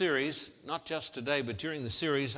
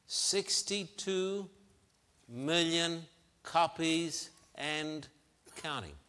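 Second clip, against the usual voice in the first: deliberate, spaced-out delivery, raised in pitch; slow emphasis; 3 semitones higher, slower than usual. Real, not acted. Preacher citing novel sales, slowly emphatic. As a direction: let each word of the figure land. Stressing a startling sales figure.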